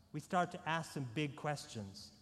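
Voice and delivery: ordinary talk, nothing marked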